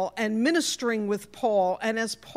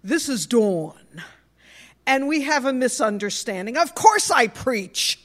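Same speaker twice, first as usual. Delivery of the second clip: put-on broad New York accent, emphatic; 4 dB louder than usual. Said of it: "in my broadest New York accent".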